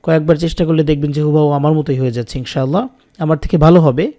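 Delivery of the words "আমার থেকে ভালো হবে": ordinary delivery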